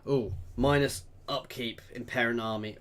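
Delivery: ordinary delivery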